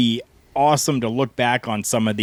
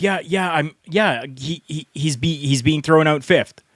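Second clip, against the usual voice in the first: higher-pitched stammering imitation; flustered promoter; 6 semitones higher than usual. Promoter answers the Patriot a second time, high and stammering. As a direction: stumble and restart, voice raised in pitch, placating.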